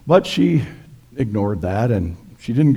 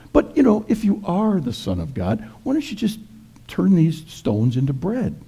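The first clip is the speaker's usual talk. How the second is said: higher, sneering tempter; the devil taunts hungry Jesus in a higher, mocking voice; 7 semitones higher than usual. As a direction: needle him with a higher taunt.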